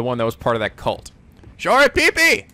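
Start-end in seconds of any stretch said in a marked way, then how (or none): 1.6-2.5 gleeful exclamation. Real, not acted. Remembering the cult name.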